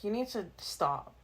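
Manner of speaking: slow, lightly voiced scolding dad; a hint of a fed-up father laying down the law; imitating dad. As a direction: quote dad slowly and firmly. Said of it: "his dad would be like".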